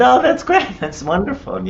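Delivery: high, pleased exclamation, lightly laughing; retold burst of friendly delight; delighted reply. Retelling her own delighted reply.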